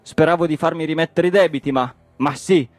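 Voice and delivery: worked-up, high-pitched harangue; indignant tirade. Acted. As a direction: rant in outraged exasperation. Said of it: "indignato sul muso".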